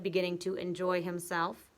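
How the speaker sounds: plain reading voice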